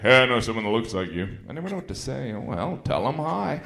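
ordinary talk, nothing marked

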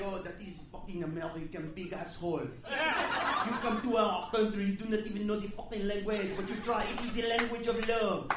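put-on lightly French-accented indignant waiter; mock the proud French waiter; a hint of a French accent; French accent impression. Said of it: "he's like".

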